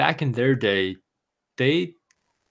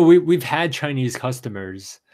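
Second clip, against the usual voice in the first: normal talking voice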